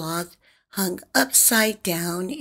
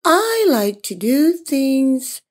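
sleepy yawning sloth; 4 semitones higher, 5 dB louder, much slower than usual. Acted. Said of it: "the sloth yawned and said".

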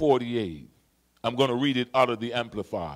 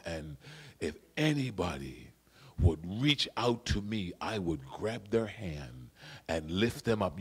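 tender quiet preaching; 7 semitones lower, 5 dB quieter than usual. Acted. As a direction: draw the church in close, gently. Preacher drops to intimate close.

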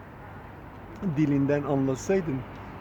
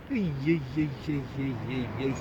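soft doting coos over a cute bird; soft cooing ay ay ay at the parrot; 2 semitones lower, 6 dB quieter than usual. Real, not acted. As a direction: melt at how sweet it is.

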